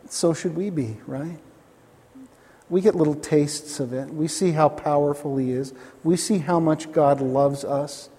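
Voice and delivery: ordinary talk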